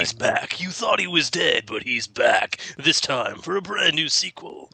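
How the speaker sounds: slow, dramatic movie-trailer voice; mock trailer announcer. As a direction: sell the sequel like a trailer narrator. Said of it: "did you know LaFontaine".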